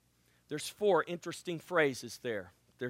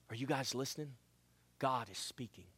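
soft, lowered God is speaking; hushed; 4 semitones lower, 11 dB quieter than usual. Acted. Preacher quietly telling the church God speaks. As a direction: draw them in quietly.

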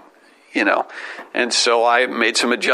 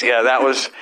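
usual speaking voice

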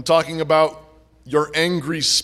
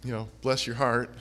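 southern saying; 6 semitones lower, 9 dB quieter than usual. Retold or imitated. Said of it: "that's what they say down south".

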